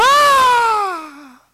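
shrill high scream of horror; neighbor's scream. Retold or imitated. Señora abre la puerta y ve a la joven: grito de horror. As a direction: scream high in shock at the door. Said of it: "el grito".

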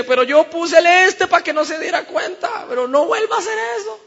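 very high, loud comic protest; hammed-up family bickering; squabbling grandparent. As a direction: yell it back in a high old-folks voice. Acted quarrel between the grandparents.

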